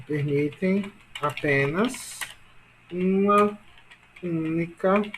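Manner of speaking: slow, word by word with pauses; slow dictation. Shown as a demonstration. Teacher dictating a rule to class, slow dictation. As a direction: dictate in small pieces for note-taking.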